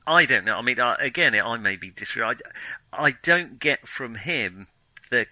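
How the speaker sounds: normal talking voice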